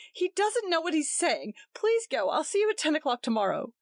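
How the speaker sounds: high anxious plea; pleading. A narrator's reading. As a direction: beg him to go. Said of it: "pleaded Gretchen".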